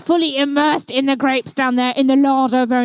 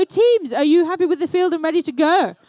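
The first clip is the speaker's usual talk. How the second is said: ordinary talk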